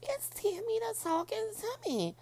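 very high, far-off movie character voice; mock high girly movie voice. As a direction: squeak it like the film character.

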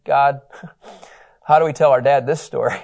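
usual speaking voice

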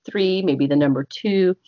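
ordinary delivery

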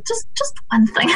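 delicate, high-pitched hedging; tactful boss. Retold or imitated. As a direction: imitate a boss gently slipping in criticism. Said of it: "Boss was like".

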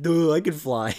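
high loud dopey Buzz; dumb voice. Retold or imitated. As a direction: play Buzz as a total dope. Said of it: "And Buzz is like".

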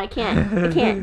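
ordinary delivery

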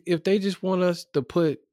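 normal talking voice

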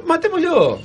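"matémoslo" squeaked very high, falling; mock outraged matémoslo. Echoes the phrase, high.

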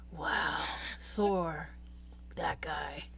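hushed, low awe with long pauses; mock rapt awe. Retelling how a couple sat glued to the audiobook, in hushed awe. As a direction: murmur it slowly like an entranced listener.

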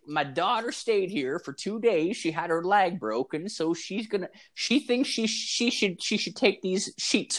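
put-on high sweet old woman voice; old lady voice. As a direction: play an innocent granny.

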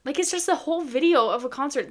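usual speaking voice

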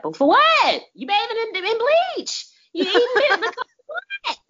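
very high, laughing disbelief; incredulous. Real, not acted. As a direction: squeal it out in disbelief. Incredulous squeal.

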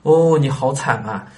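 slow, drawn-out fake sympathy; the clever man's empty comfort; hollow sympathy. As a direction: coo it without meaning it. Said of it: "聪明人的回答是".

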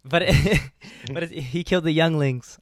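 real giggles breaking into the words; laughing. Real, not acted. Podcaster arguing about Anakin with co-host, laughing. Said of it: "I don't know why I'm laughing".

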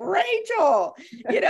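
put-on high teasing kid voice; teen son voice. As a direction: call her name like a teasing teen. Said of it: "And it's like".

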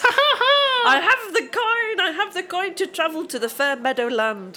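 high, hammed-up goblin proclamation; laughing storybook creature voice; gleeful hobgoblin. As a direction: crow it like a triumphant little goblin. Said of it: "bartender was an actual hobgoblin".

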